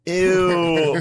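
high grossed-out ew; disgusted girls. Retold or imitated. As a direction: squeal it in disgust. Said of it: "they're all gonna be like".

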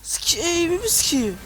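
loud high angry yell; loud high exasperated yell; frustrated shout. Real, not acted. Gamer shouts at slope.

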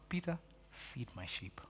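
very soft, slow, low and gentle; tender Jesus. Retold or imitated. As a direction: lay the charge down softly. Jesus voiced, final charge.

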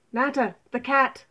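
loud, urgent alarm call; called-out warning. Acted. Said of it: "the toad called out".

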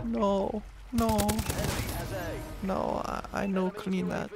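soft, hushed, timid maid voice. Pretend. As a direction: whisper it like a shy maid. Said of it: "Sound like the fucking maid from Family Guy".

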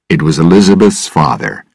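usual speaking voice

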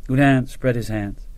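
ordinary delivery, nothing marked

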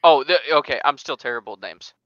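usual speaking voice

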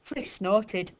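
ordinary delivery, nothing marked

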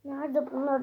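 ordinary talk

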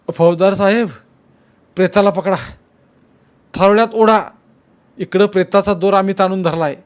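high, loud desperate calls, rising to the end; strained shouting. Acted. Men holding the rope calling to the officer, urgent shouting.

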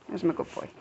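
calm him down gently; soft praise. Real, not acted. Owner gently praising Colt after scolding him, soft.

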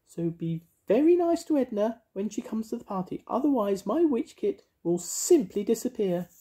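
high, sing-song witchy voice; bright, bossy little witch. Acted. Mavis voiced.

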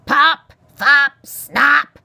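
high, very loud irritated shouts; loud cry. A narrator's reading. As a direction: bellow out each botched name. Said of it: "Aerith cried".

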